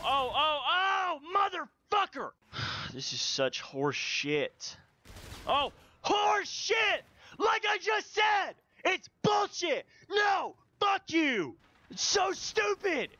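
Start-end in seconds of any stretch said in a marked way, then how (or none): 0.0-2.3 rising, high-pitched cursing; angry outburst. Real, not acted. Player losing a fight in game, angry outburst. 5.5-13.1 high, punched-out angry shouting; real rage. Real, not acted. Player raging at an unfair game, furious shouting.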